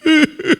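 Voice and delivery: high mock sob; imitated sobbing. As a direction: burst into exaggerated weeping. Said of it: "saya nangisnya kayak gitu". (isak tangis tiruan)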